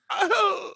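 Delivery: high, held, autotune-like yelp. Real, not acted. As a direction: let the voice jump up into one steady synthetic note. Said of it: "Why did your voice just go fucking [name]?".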